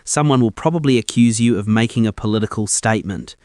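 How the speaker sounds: usual speaking voice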